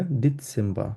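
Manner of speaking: ডিসেম্বর as "dit-sem-ba". Shown as a demonstration. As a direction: put a TS in ডিসেম্বর, no final R. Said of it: "সেটটা এস এর মতো উচ্চারণ". (ডিসেম্বর-কে "ডিৎসেম্বা")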